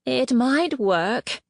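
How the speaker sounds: a slow, high plea, hoping against hope; beg, voice lifted high and drawn out; desperately. Acted. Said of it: "Greatness said desperately".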